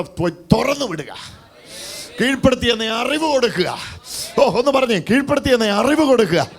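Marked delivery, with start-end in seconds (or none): 2.2-6.5 high, emphatic exhortation; pitched-up revival call; forceful preaching. Acted. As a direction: urge the congregation with raised voice.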